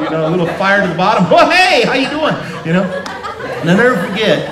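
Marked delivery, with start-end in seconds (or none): 1.3-2.3 high, bright startled greeting; mock perked-up kid. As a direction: snap to attention like a jolted kid. Kid perking up after spank.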